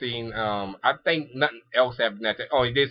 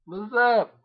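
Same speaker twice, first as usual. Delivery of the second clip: high, teasing put-on greeting; a playful, higher-pitched taunt; trolling look; 9 semitones higher than usual. Recapper imitates Ogami greeting her with that look, trolling. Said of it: "He gave her that look like".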